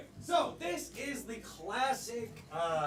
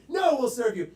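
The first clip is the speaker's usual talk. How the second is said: acted contradiction; 7 dB louder than usual. Bartender flip-flopping in acted back-and-forth.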